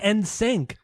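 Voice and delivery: mock "sync" as "sank"; mimicked mispronunciation. Correcting cohost who says sank, mimicking. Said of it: "but you're saying".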